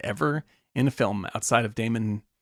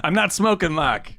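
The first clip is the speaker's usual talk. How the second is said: higher-pitched imitation of a Boston accent; 5 semitones higher than usual. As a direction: quote the movie line with a Boston accent. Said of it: "the Dunkin' Donuts".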